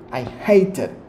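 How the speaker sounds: "hated" punched out as the strong word; stress on hated. Shown as a demonstration. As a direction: lean hard on "hated". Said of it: "you have to stress those things".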